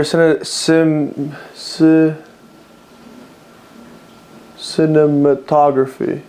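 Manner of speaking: stumbling into "cinematography", breaking off at sin, sim, sih; sounding out. Real, not acted. Unsure of the word, "cinematography" slowly sounded out after false starts. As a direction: feel out "cinematography" slowly, syllable by syllable. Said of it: "I think that's how you say it".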